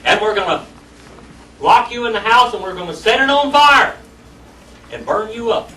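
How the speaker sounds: mock menacing threat. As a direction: growl the threats like a bully.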